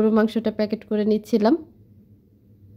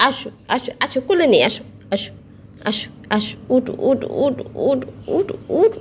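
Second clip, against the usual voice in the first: cooing come here, come here to a pet; coaxing a pet bird; 3 semitones higher than usual. Real, not acted. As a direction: coax the little bird onto your lap.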